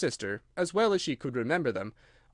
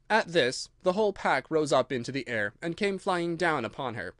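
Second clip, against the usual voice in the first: plain reading voice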